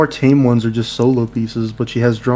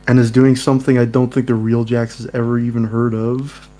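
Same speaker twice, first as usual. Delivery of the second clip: usual speaking voice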